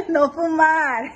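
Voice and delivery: slow, laughing correction. Real, not acted. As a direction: say it slowly while laughing. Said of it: "mom correcting son gently, laughing".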